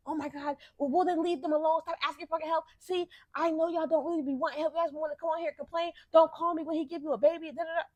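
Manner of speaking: mock lecturing voice; mocking commenter. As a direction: parody the know-it-all commenter.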